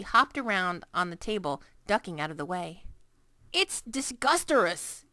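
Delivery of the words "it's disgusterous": gurgled. A narrator's reading. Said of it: "the B F G gurgled".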